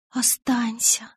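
slow, breathless begging whisper; pleading whisper. Acted. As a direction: whisper the plea. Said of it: "умоляла я".

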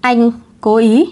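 slow, insistent accusation; cố cãi. A narrator's reading. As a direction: draw it out as a stubborn protest. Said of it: "Tạ Hải Vân cố cãi". (cố cãi, kéo dài từng chữ)

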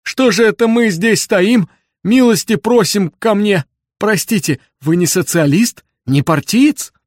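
put-on high, loud, flustered old man; agitated old man. A narrator's reading. Old man invites Bender inside, high agitated voice. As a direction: invite eagerly in a high, excited voice. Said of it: "заволновался старик".

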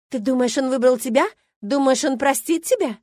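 loud, high, scornful questions; angry challenge. A narrator's reading. Milena challenges whether Adam will forgive her rival, furious. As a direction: throw the questions at her, slowing on the second.

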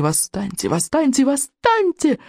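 from a whisper rising to a high cry. A narrator's reading. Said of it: "сначала тихо, шёпотом, затем всё громче и громче".

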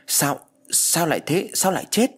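high-pitched shocked questions, rising at the ends; stunned disbelief. A narrator's reading. Friend learning of Dung's sudden death, shocked and disbelieving.

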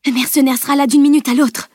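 high, pressing warning; urgent stage whisper. A narrator's reading. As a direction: warn urgently under pressure. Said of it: "chuchota-t-elle".

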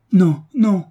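high, loud cry of protest; urgent, pleading outcry; impetuous anguished plea. A narrator's reading. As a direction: burst out in pained refusal. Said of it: "avec l'impétuosité de la douleur et de la prière".

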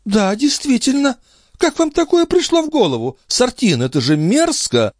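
very high-pitched feminine scolding; indignant countess. A narrator's reading. Countess scolding Sartine for opening it, high and indignant. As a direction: scold him in a lady's high voice.

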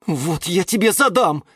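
high-pitched outraged threat; threaten, choking with indignation; high, huffy outburst; indignant threat. A narrator's reading. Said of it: "задохнулась от возмущения".

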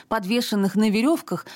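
ordinary delivery

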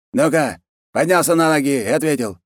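high-pitched impatient command; irritated order. Acted.

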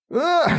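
high gasping cry; shrill dying gasp, cut short; death cry. Acted. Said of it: "послышалось от второго".

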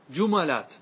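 جملات as ju-ma-lat; three syllables in جملات, "ma" in the middle; light fatha. Shown as a demonstration. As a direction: put an "a" after the م in جملات. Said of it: "فتحة".

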